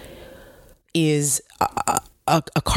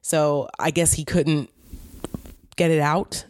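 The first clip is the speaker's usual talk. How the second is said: ordinary delivery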